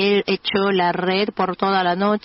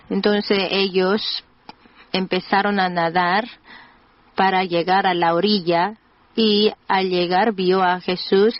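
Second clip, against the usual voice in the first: ordinary talk, nothing marked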